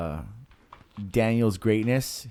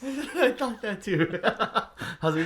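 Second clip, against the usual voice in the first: laughing; slower than usual. Real, not acted. Laughing at co-host's joke.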